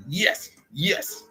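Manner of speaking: high, celebratory yeses; acted triumph. Comic seeing the jerks' ball gone, triumphant.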